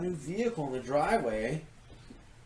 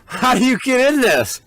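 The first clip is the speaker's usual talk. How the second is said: excited; 9 semitones higher, 11 dB louder than usual. Real, not acted. Excited at the truck.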